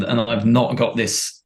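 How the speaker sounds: usual speaking voice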